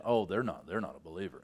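low, dropping, dismissive aside; dismissive judgment. Retold or imitated. Churchgoer judging someone's faith, low and dismissive. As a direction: write them off under your breath.

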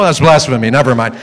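normal talking voice

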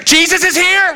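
high-pitched hammed-up scream; overemotional drama queen. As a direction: squeal the news like a drama queen. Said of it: "Very emotional".

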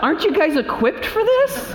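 high-pitched mock disbelief; incredulous. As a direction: protest in baffled, high-voiced disbelief. Baffled reply to the nurse.

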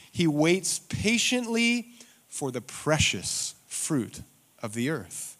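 slow, deliberate scripture reading. Shown as a demonstration. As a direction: read it slowly, with gaps between phrases. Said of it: "You have to read this slowly".

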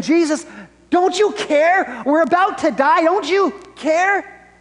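high, loud panicked plea; acted-out frantic cry for help; losing it. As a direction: wail it desperately, stretching out CARE. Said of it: "They're losing it".

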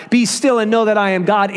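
commanding rebuke, punching "Be"; stern rebuke. Acted. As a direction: snap "Be still and know that I am God" at the chaos. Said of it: "It's a rebuke to the chaos".

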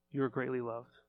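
soft, intimate reassurance; tender assurance. Real, not acted. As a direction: hush down and speak it straight to one person. Personal word to congregant.

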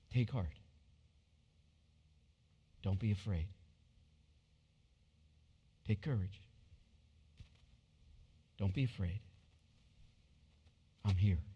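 Jesus reassuring a frightened listener; tender reassurance. Acted.

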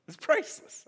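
high, bright delight with a rise; excited. Real, not acted. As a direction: burst out with glee. Repeats with delight.

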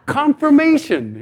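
loud, high and slow, laughing through the word; laughing exclaim. Real, not acted. Preacher laughing to the congregation about the prophecy. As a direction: burst out laughing as you name the irony.

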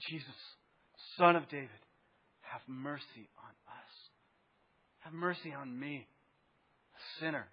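soft, low pleading prayer; hushed cry for mercy. Shown as a demonstration. Preacher showing the church how to cry for mercy, softly pleading. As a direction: plead quietly, as if barely daring to ask. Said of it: "We need a cry as a church to say".

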